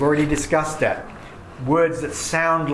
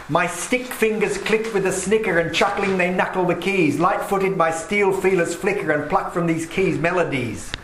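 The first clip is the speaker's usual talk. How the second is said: crisp, clicking poem reading, a bit higher; poem recital; 3 semitones higher than usual. Shown as a demonstration. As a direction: read it aloud to show harsh sounds. Teacher reads cacophony poem aloud.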